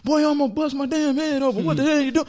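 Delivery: high, fast furious dad rant; angry dad. Retold or imitated. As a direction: snap at the son in a rush. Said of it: "He was like".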